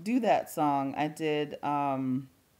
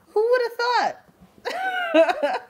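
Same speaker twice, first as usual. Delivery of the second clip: much higher, louder, slow and laughing; giddy laughing question; laughing delight; 8 dB louder, much slower than usual. Real, not acted. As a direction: stretch it out through real laughter. Gushing over movie cast.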